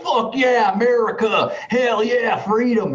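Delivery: high-pitched mock patriotic cheer; stoked dudes imitation. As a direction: imitate fired-up bros hyping America. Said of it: "dudes were getting stoked about it".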